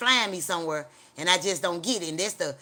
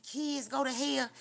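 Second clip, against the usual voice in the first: higher, slow disbelieving question; slow, higher shocked disbelief; horrified question; 7 semitones higher, 4 dB quieter, slower than usual. Retold or imitated. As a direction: ask it stunned and appalled.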